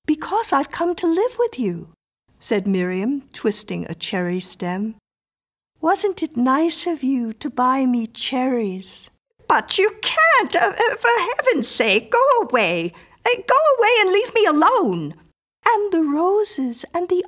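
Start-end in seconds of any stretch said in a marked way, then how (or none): 0.1-1.9 put-on high little-girl voice; child announcing. A narrator's reading. Miriam announces she is moving in. 5.8-9.1 a hint of a pleased child; slightly high, sweet-mannered little girl; lightly voiced girl. A narrator's reading. Miriam coolly chats while moving in. 9.5-15.1 high, loud, panicky outburst; acted frantic protest; desperate plea. A narrator's reading. Mrs Miller begs Miriam to leave.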